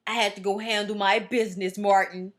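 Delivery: a hint of a prim therapist; nerdy dude impression. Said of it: "He's like that nerdy dude".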